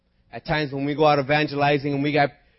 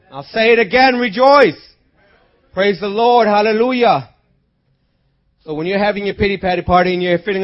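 raised, louder preacher's delivery; forceful preaching; 8 semitones higher, 10 dB louder than usual. Real, not acted. Preacher urging the church to rejoice, forceful exhortation.

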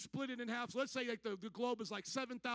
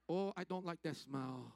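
low, slow, badly faked Indian accent; 4 semitones lower, slower than usual. Indian people smelling visitors, badly faked Indian accent.

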